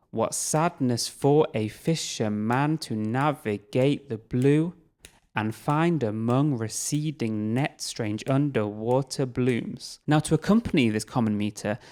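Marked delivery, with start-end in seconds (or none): 0.1-10.0 slow, even beat on every stress; rhythmic reading. Shown as a demonstration. Teacher reads the stanza to a steady beat. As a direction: read it like ticking to a metronome. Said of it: "perform this poem to a rhythm".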